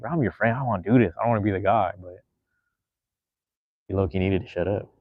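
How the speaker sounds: privately thinking it about a friend, softly; inner thought. Retold or imitated. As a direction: say it under your breath as if only thinking it. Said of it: "I didn't say this out loud".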